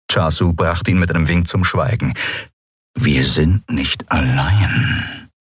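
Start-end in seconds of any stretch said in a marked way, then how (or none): 2.9-5.3 slow, lower growl. A narrator's reading. Chasu warns his henchman of a listener, growling. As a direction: growl it out, drawing the words out. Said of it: "knurrte er".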